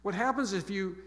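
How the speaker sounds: ordinary talk, nothing marked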